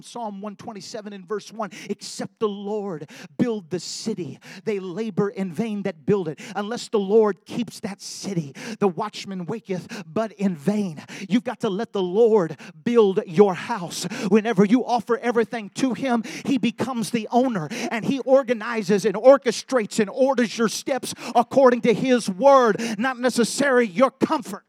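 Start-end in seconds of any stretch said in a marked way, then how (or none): 1.9-11.0 slow, louder solemn recitation; scripture reading. Acted. Preacher quoting Psalm to the congregation, slow and solemn. 11.3-24.6 much louder, driving sermon voice; full-force preaching. Acted. Preacher rousing the congregation, loud and driving.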